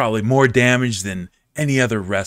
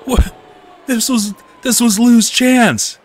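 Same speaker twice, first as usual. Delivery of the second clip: loud, high-pitched shocked outburst; mock-outraged commentator, high and loud; outraged disbelief; 10 semitones higher, 6 dB louder than usual. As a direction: cry out like a commentator whose favorite was robbed. Outraged shock as wrestler ruins match.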